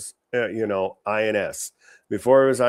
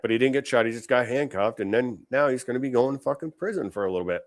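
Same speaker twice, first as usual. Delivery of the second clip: usual speaking voice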